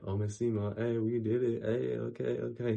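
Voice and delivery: excited cheer that it's done. Real, not acted. Celebrating finishing the video.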